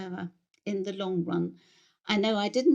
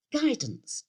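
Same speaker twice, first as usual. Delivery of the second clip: high-pitched "guidance", falling at the end; vicar's wife agreeing; 6 semitones higher than usual. Acted. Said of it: "she said".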